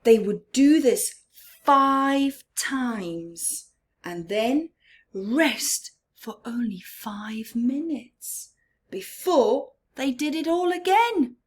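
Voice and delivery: ordinary delivery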